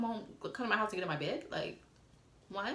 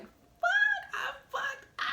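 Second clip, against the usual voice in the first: much higher squeal, laughing through the words; laughing panic; 3 dB louder, much slower than usual. Retold or imitated. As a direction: squeal it while cracking up at your mistake. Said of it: "'cause I'm like".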